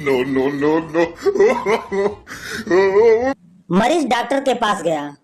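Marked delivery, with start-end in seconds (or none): 1.1-3.3 soft chuckle. Real, not acted. Laugh between jokes.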